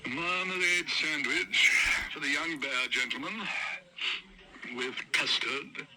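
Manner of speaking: drawn-out, disdainful dictation; slow disdainful order. Acted. Waiter slowly repeats Paddington's odd order. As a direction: spell out the order slowly, with distaste.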